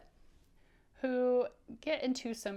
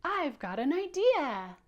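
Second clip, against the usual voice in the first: higher and louder excited "I've got an idea"; 6 semitones higher, 6 dB louder than usual. Shown as a demonstration. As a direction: bounce with excitement. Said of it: "you're so excited and say".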